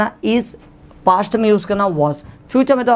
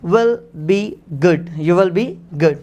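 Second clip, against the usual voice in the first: slow dictation; 3 semitones lower, much slower than usual. Shown as a demonstration. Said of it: "so आप कहेंगे you".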